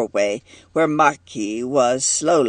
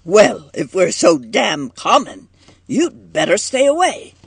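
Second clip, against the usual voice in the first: loud, high angry retort; heated, forceful warning; violent outburst; 7 semitones higher, 5 dB louder than usual. A narrator's reading. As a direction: burst out angrily, pitch climbing. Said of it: "burst out Marky violently".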